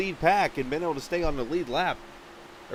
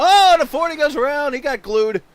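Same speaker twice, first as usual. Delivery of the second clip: excited call; 9 semitones higher, 10 dB louder than usual. Real, not acted. Car spins on pit road.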